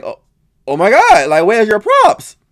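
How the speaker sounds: oh my God, where's your props, rising in disbelief; disbelieving gasp at the stage; incredulous. Retold or imitated. Viewer reacting to a contestant with no props, incredulous.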